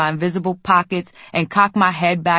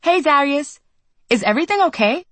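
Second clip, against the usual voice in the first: put-on sweet girl voice; 9 semitones higher than usual. Carrie's voice, higher.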